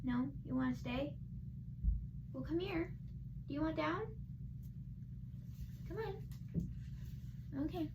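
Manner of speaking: very soft, gentle coaxing questions. Real, not acted. Vlogger asking someone if they want down, soft coaxing. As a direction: coax a little one in a hushed voice.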